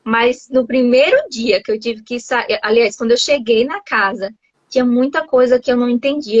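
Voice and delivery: ordinary talk, nothing marked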